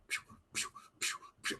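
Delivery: voiceless, soft whooshes for fired balls; imitated pew. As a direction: puff out quick pitch sound effects. Imitating rapid pitches being fired.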